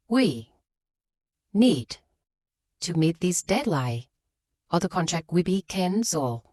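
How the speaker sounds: "need" set apart by a clear pause before and after; stop, land firmly on "need", stop again; pauses around need. Shown as a demonstration. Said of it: "add a pause before and after".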